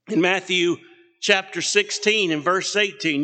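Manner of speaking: usual speaking voice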